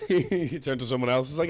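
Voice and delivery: usual speaking voice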